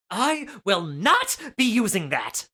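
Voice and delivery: put-on slow, firm teen girl's refusal; a hint of a disturbed teen girl refusing. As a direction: spell out the refusal slowly. Teen girl declining firmly.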